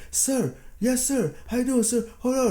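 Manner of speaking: high, rushed yes-sir voice, hammed up; mock eager soldier. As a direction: ape the soldiers fawning over the president. Soldiers fawning over the president.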